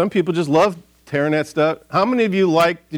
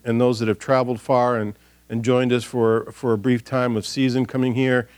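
prayer; 4 semitones lower than usual. Real, not acted. Leads a prayer in service.

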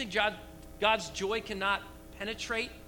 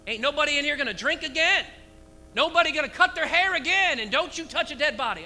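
loud, high mock decree; mock stern command; 7 semitones higher, 9 dB louder than usual. Preacher to church, jokingly loud. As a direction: bark out the rules as a joke. Mock Nazarite vow rules, then "just playing.

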